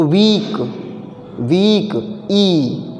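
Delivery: वीक said twice with a long held ई, then ई alone; drawn-out वीक, pausing between each; vowel-counting demo. Shown as a demonstration. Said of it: "अब आप वीक को बोलोगे और count करोगे".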